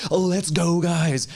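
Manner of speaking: a hint of Macho Man Randy Savage; Macho Man hype. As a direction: ham it up like a wrestling promo. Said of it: "They're Macho Man Randy Savaging".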